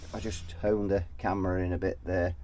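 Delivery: ordinary delivery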